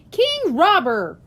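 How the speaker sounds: put-on high, loud cheeky boy voice; cheeky kid joke. A narrator's reading. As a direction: shout the joke like a class clown. Kid joke, class laughs.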